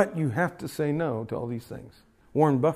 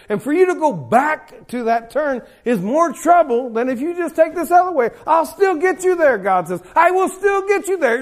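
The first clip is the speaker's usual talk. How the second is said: loud, high, impassioned preacher's climax; full-force preaching; 10 semitones higher, 7 dB louder than usual. Acted. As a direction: preach it hard to the congregation.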